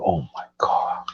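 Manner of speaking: breathless whisper of delight; awed whisper. Real, not acted. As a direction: whisper it like the taste is too good. Savouring a grilled cheese memory.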